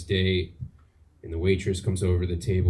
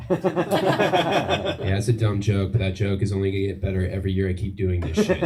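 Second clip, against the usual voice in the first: ordinary talk, nothing marked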